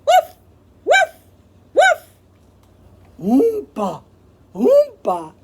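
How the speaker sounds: loud barks and oompas; animal calls. Acted. Zoo animals call out loudly. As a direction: bark and honk like zoo animals.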